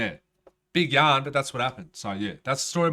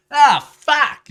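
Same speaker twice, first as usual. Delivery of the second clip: loud groan; 14 semitones higher, 15 dB louder than usual. Pretend. Imagined self at fifty on porch.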